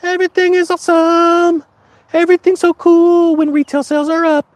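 very high, airy mock cheer; sing-song mock of gullible news viewers; mocking gullible fan. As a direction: parody a brainwashed cheerleader. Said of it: "morons reading those headlines".

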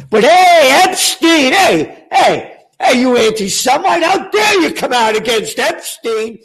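loud, indignant burst; mock outrage. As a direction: protest loudly, as if scandalized. Mock outburst over Epstein's critics.

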